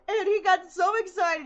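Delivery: ordinary talk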